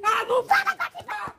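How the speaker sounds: shouting tantrum. Acted. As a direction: yell it as a sulky refusal. Repeated tantrum line.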